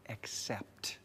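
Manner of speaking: whispered word. Acted. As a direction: drop to a whisper on "accept". The word people react to.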